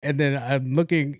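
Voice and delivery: ordinary talk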